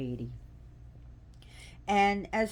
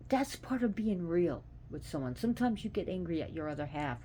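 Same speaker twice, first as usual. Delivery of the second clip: normal talking voice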